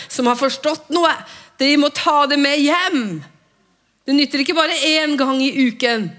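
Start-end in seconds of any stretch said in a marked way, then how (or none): none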